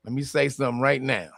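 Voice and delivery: normal talking voice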